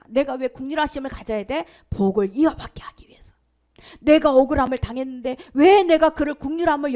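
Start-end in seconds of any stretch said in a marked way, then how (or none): 1.9-3.3 hushed whisper; whispered answer. Real, not acted. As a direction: drop to a whisper to land the answer. Preacher answers own question.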